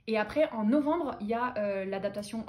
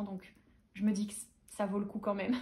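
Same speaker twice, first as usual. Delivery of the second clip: ordinary talk, nothing marked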